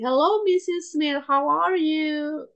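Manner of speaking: warm, lilting phone welcome; warm greeting. Shown as a demonstration. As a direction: greet a customer warmly, stretching the words. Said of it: "ngasih sapaan yang hangat".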